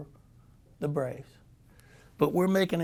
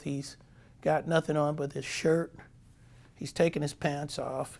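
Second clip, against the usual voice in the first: ordinary delivery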